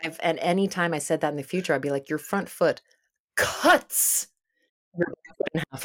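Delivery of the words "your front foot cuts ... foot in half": overemphasize cuts, crisp ending without extra letter; stress on cuts. Retold or imitated. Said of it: "overemphasized the word".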